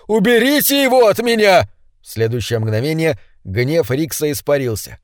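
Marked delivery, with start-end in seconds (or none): 0.1-1.7 высоким чужим голосом, громко; кричи в панике; испуганный крик. A narrator's reading. Бун продолжает кричать. (Бун просит защиты: высокий испуганный крик)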